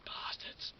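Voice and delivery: a breathy whispered curse. Retold or imitated. The younger man whispering his curse about the staff. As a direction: hiss it under your breath. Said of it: "he was like".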